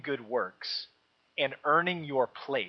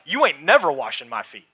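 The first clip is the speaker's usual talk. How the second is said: loud, punchy "You ain't never washing my feet"; emphatic slangy refusal; 9 dB louder than usual. Shown as a demonstration. Preacher voicing Peter's double negative, loud and emphatic. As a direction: blurt it out like a stubborn kid refusing. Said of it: "even more emphatic in the Greek".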